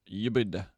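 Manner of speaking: "Ubud" as YOO-bid, low and falling; trying a pronunciation. Shown as a demonstration. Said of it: "How do you say Ubud?".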